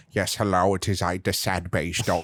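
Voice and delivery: put-on low, soft German-accented drone; gloomy German filmmaker voice; Werner Herzog impression. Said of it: "I just hear Werner Herzog".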